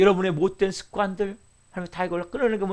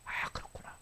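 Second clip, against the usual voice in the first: 1 hushed whisper; 16 dB quieter than usual. Acted. Quiet inner realization quoted.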